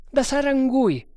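high, loud answer; urgent sergeant. Acted. Sergeant naming the traitor to his captain, urgent.

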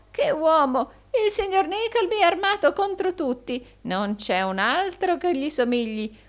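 high, loud gushing flattery; cackling, exaggerated admiration; gurgling old fox. A narrator's reading. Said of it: "vecchio volpone che gorgogliava in questo tono".

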